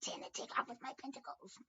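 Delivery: squeaky high cartoon voice, fast at the end; high mock voice for the figure on the tarot card; silly character voice. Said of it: "I don't know why I use that voice".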